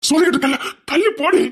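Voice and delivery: high, loud order to get away; angry shout. Acted. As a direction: shove her off with a shout. Husband pushes wife away. (உயர்ந்த குரலில் கோபமான கத்தல்)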